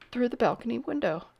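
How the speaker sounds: ordinary delivery, nothing marked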